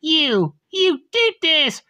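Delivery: high-pitched angry accusation; angry confrontation. Acted. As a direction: confront the culprit, pitched well up. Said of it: "Nellie just confronts Tiffany".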